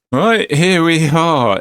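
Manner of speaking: high, slow put-on voice; slow, high arrival line; character voice.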